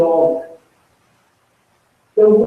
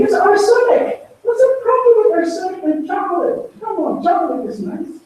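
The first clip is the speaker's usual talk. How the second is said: high, fast scoffing voice, brushing off the poison; mocking dismissive believer; 10 semitones higher, faster than usual. Preacher mocks a believer who shrugs off arsenic, scoffing. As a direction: mimic a careless voice waving away danger. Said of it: "it's like saying".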